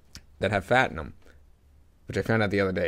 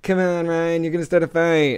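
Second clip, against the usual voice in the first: higher, drawn-out mock plea; imitated pleading girlfriend; 5 semitones higher, slower than usual. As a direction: play a girlfriend begging her man to back off. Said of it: "being out with your girlfriend".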